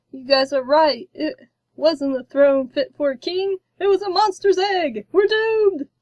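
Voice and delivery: a king sure he is doomed; terrified wail. A narrator's reading. Terrified king cries doom.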